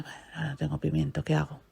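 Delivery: put-on fast hushed whisper, the baby is asleep; mother whispering to herself in the kitchen. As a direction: whisper quickly so as not to wake the baby.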